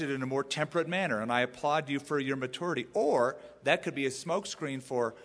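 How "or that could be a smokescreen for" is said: ordinary talk, nothing marked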